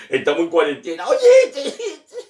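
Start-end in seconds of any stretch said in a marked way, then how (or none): 1.1-2.2 high, loud laughing call-out. Real, not acted. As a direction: laugh through the words. Laughing while starting next joke.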